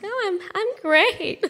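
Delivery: high, bright mask of a voice; fake cheerful. Wearing a pretty mask at church, chirpy fake great. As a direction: sing out the pretend fine. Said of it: "put on this pretty mask".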